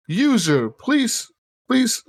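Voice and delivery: very high pleading mechanical voice; pleading robot gun. Retold or imitated. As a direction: beg like a squeaky little robot pistol. Skippy gun voice in retold story.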